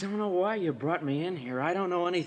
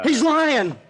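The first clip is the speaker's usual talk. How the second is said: much higher and louder, panicked protest; desperate shout; 8 semitones higher, 7 dB louder than usual. Acted. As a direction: kid cracking and yelling that Eddie lies. Suspect breaks under pressure.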